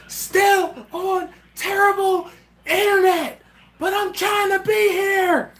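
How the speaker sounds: drawn-out, high-pitched comic shout; mock yelling. Host acts out a viewer's yelled comment, mock yelling. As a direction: holler it slowly, word by word, way up high. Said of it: "He's yelling".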